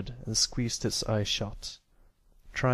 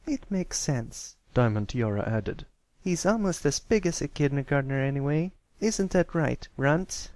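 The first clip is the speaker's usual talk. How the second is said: higher, put-on mean-girl voice; taunting schoolgirl bully; 5 semitones higher than usual. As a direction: needle the little one, pitched up.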